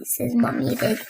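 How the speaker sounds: plain reading voice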